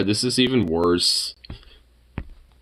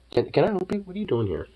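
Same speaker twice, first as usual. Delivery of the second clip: pet voice; 8 semitones higher, 4 dB quieter, faster than usual. Real, not acted. Mock-formal high voice to pet.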